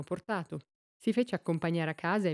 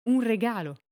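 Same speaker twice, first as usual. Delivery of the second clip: louder, higher shocked cry; the dawning suspicion bursts out loud; shocked exclamation; 4 semitones higher, 5 dB louder than usual. A narrator's reading. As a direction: blurt it out in sudden horror. Widower realizing wife's gifts.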